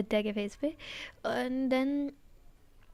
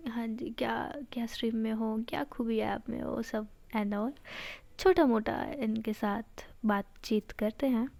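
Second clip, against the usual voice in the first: usual speaking voice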